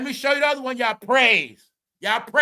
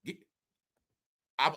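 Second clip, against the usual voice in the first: ordinary talk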